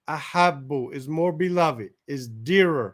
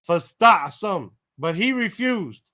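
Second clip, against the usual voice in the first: ordinary talk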